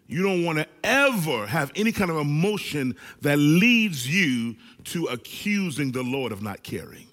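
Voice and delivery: normal talking voice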